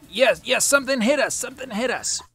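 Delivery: high, loud and frantic; a droid driver yelping in a panic; panicked droid. Acted. Panicked droid driver after impact.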